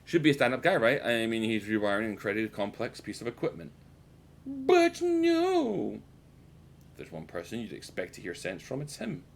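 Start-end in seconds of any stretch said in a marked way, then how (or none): 4.5-6.0 very high, drawn-out falsetto; loud falsetto wail of protest; mock outraged no. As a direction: squeal it comically high and hold the last word. Comic outburst after building expectation.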